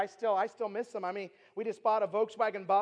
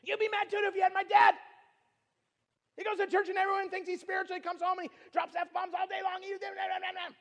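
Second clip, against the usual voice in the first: high-pitched imitation of a ranting girl; mad rant; 10 semitones higher, 4 dB louder than usual. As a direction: start in a shout, race into high angry babble. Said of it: "Why are you so mad?".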